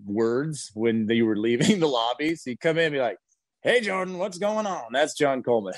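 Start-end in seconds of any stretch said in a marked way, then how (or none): 3.6-4.9 higher, mock-cheerful hello; jolly greeting. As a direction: imitate a jolly older man greeting you. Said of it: "happy and jolly".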